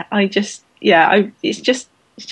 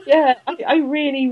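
ordinary talk, nothing marked

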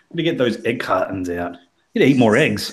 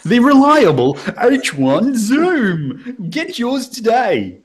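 ad voice; 10 semitones higher, 4 dB louder, slower than usual. Pretend. Parody microphone advert.

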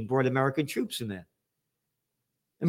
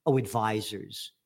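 sarcastic; 7 semitones lower, 5 dB quieter than usual. Real, not acted. Sarcastic repeat of news word.

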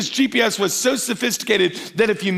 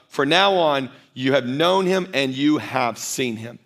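lower, hushed and deliberate, each phrase set apart; solemn scripture reading; 6 semitones lower than usual. Acted. Pastor reading Jesus's words to the congregation, solemn and hushed. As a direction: let every phrase land like a weighty claim.

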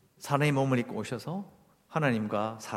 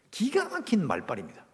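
ordinary talk, nothing marked

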